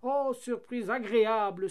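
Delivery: high, loud joyful exclamation; excited little shout; delighted cry. A narrator's reading. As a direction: cry out in happy surprise. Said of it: "s'écria le papier".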